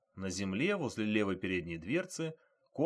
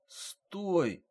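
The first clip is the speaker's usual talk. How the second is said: ordinary delivery, nothing marked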